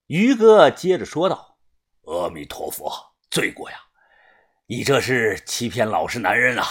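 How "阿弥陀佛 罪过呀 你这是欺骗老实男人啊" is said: low, solemn tut-tutting; mock-pious reproach. A narrator's reading. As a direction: intone it low like a monk chiding a sinner.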